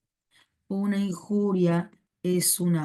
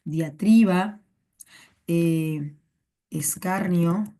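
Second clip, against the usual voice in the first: slow, spaced-out dictation with long pauses; each synonym held apart, rising at the end; slower than usual. Shown as a demonstration. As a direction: give each word slowly so they can write it down. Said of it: "Apunta, apunta los otros sinónimos".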